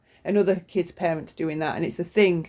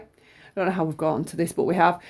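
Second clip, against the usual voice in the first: ordinary delivery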